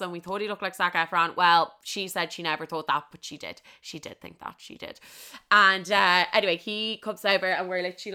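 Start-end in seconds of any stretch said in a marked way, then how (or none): 3.1-5.0 hushed, insistent whisper; low conspiratorial whisper; whispered aside. Real, not acted. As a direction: whisper it as a sly correction. Confidential aside contradicting her friend.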